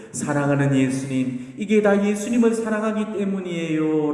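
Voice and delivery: mild mock shout sent skyward; crying out. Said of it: "큰 소리로 이렇게 외쳐보렴".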